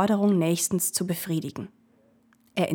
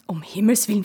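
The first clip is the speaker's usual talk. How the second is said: alarmed whisper; 4 dB louder, faster than usual. Acted. Ferryman alarmed by gold.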